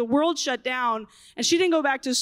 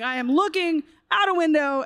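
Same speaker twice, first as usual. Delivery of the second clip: ordinary talk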